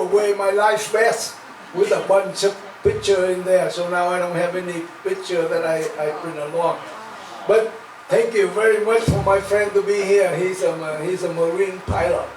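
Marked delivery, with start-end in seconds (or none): none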